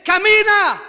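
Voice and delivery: loud, high one-word command; commanding healing cry. Retold or imitated. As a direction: call it out with full authority.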